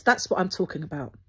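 ordinary talk

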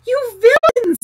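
high, loud furious yell; shouting, transported with passion; outraged shout. A narrator's reading. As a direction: yell it in an indignant rage. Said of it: "he shouted, shaking".

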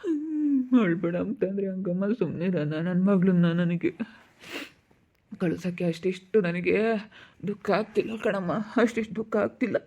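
acted broken, tearful grief; weepy parent in sorrow; crying. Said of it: "ಸುಮ್ನಿರು, ಅಳ್ಬೇಡ".

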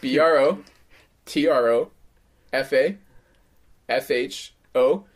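slow letter-by-letter dictation; spelling out. Shown as a demonstration. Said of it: "at, at sign".

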